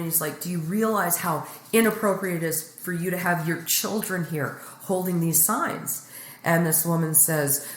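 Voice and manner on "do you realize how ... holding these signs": usual speaking voice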